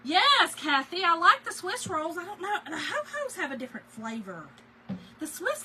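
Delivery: loud, high, eager answer to a viewer; gushing back at a chat comment; excited reply. Real, not acted. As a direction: perk right up and answer her loudly.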